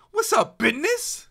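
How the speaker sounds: squeaky high hype greeting; high put-on greeting. Streamer greets chat in a squeaky high voice. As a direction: pitch it way up like a cartoon.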